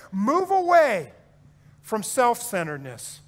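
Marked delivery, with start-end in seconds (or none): 0.1-1.1 higher, louder and slow; climactic appeal. Real, not acted. Preacher urging the church to leave self-centredness, rising to a loud peak. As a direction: build to the peak and hold it.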